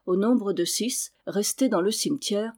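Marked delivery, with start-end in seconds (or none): none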